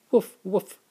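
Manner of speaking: high, loud playful woof woof; cheerful doggy barks. Acted. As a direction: bark like a puppy for the kids. Dad barks for the dog.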